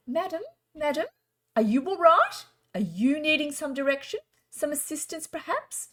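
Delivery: high, prim posh lady asking politely. Retold or imitated. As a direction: put on a high well-to-do lady's voice. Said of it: "very posh as well in how she spoke".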